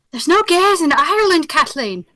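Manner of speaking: exclaimed in a mock Irish accent; Irish accent impression. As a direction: do the Irish farmer protesting to his wife in an Irish accent. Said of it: "talks in the Irish accent".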